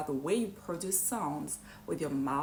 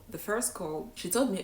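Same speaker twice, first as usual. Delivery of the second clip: usual speaking voice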